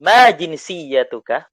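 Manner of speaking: "Ma jinsiyatuka?" slow and clear, loud rising question; teacher modelling "Ma jinsiyatuka?" for repeating; model Arabic phrase. Shown as a demonstration. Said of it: "Diulang bersama saya".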